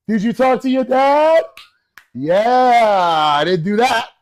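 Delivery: high, loud mock mom quizzing her son. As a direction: play the wife asking it loud and high.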